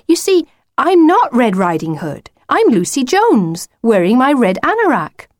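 matter-of-fact Lucy, correcting. Acted. Lucy telling the wolf who she is, firmly correcting. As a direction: set him straight in a high, lively voice.

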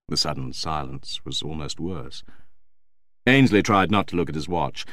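ordinary delivery

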